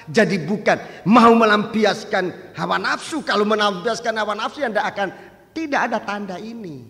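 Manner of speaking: thundering sermon climax; preaching at full force. Acted. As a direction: proclaim it to the whole church, building.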